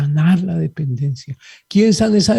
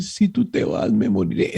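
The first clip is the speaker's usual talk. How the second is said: dependent lover; 6 semitones lower, 5 dB quieter than usual. Retold or imitated. Priest mimicking a clingy spouse, mock melodramatic plea. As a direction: moan it like a lover who can't live alone.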